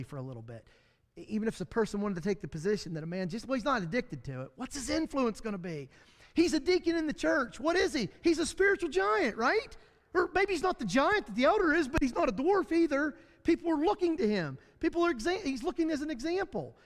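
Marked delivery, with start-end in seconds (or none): 6.3-16.8 higher, louder preaching. Acted. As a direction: drive the point home from the pulpit. Preaches at full force.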